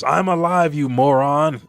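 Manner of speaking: loud, high irritated retort; indignant yell. Acted. Injured Loki answering Luffy, indignant yell. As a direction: yell back at an idiot.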